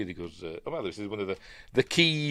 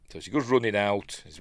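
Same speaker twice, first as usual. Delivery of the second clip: ordinary talk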